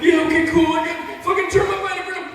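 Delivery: high, loud, laughing panic voice; panicked friend on phone. Retold or imitated. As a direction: squeal like a drunk buddy begging for rescue. Rita's voice agreeing.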